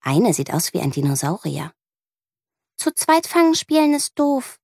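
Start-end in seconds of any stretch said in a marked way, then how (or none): 2.8-4.6 put-on high, pouty little girl; girl telling her there's no fun with two, high and pouty; child complaining. A narrator's reading. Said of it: "sagt Amelie".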